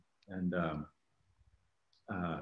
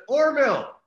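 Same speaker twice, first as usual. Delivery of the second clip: calls out his name high and loud, starstruck; excited call-out; 10 dB louder than usual. Retold or imitated. Fan calling to the popcorn guy in an airport, excited shout. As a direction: hail him across the airport, thrilled.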